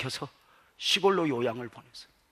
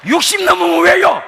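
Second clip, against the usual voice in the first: high, loud hammed-up protest; mock shout; 15 dB louder than usual. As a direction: shout the question in comic alarm. Pastor's retort to elder.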